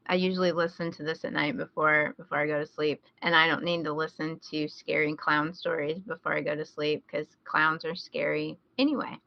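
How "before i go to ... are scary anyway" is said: ordinary talk, nothing marked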